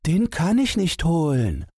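high mouse voice with a touch of sadness; a tiny mouse, downcast; high mouse voice, mildly sad; sad little mouse. A narrator's reading. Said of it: "rief das Mäuseken traurig".

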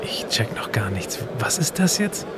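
ordinary talk, nothing marked

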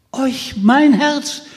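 höher, gedehnt, entsetzt; entsetzter Schrei. Acted. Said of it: "schrie Peter mit Entsetzen". (Peter schreit Michel entsetzt an, mit Entsetzen)

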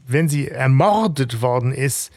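usual speaking voice

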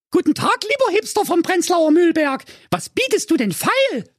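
sehr hohe Mädchenstimme; Kathi Wittchen. A narrator's reading. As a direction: ruft naiv und piepsig. (piepsige Mädchenstimme)

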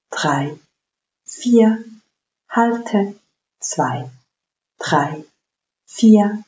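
slow, paced breath counting. Acted. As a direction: count each breath calmly and evenly. Counts the box breathing aloud.

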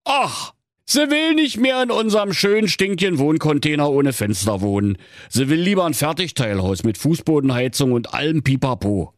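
high, put-upon husband; complaining husband. Acted. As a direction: moan about the wife's demands.

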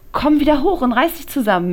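playful scolding, high, loud and fast; laughing grumble. A narrator's reading. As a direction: tease her while scolding. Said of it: "meckert sie und lacht dabei". (lachend meckern, hoch, laut und schnell)

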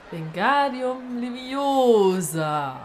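slow, sustained spell-casting; careful incantation. Acted. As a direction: draw the spell out with care. Repeats spell as corrected. (Zauberspruch langsam und gedehnt)

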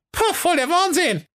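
very high squeaky dwarf voice; dwarves calling. A narrator's reading. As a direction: shout it out like tiny dwarves. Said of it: "riefen sie". (hohe, quäkende Zwergenstimme)

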